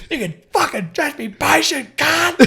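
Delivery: high imitated yell, rising in volume; furious retort. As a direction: shout it across as the angry driver. Acted shouting match resumes.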